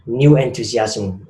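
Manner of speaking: normal talking voice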